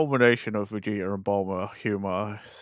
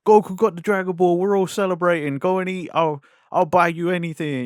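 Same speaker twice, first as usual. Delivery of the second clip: imitating an eager host; excited party invite; 6 semitones higher than usual. Friends retelling how the host excitedly invites him out to eat. Bulma retells the celebration call.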